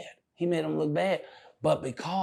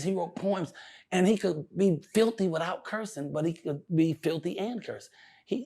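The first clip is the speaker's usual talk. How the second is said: usual speaking voice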